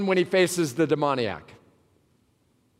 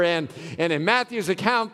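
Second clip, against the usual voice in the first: ordinary delivery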